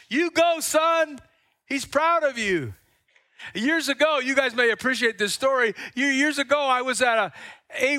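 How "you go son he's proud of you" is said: high, proud cheer; cheering God. Retold or imitated. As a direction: cheer him on like a proud father.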